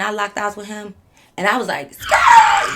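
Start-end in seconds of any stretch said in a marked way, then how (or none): 1.9-2.7 very high, loud screeched "skrrt"; imitated tire screech. Driver pulling off from his house, high screeched car noise. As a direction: screech like tires peeling away.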